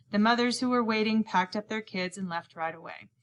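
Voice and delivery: plain reading voice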